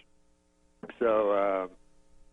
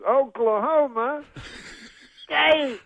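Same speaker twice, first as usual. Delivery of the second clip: slower, high loud crowd call; Bill Cosby; 13 semitones higher, 4 dB louder, much slower than usual. Retold or imitated. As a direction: stretch it out, big pause before State. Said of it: "he says it slower".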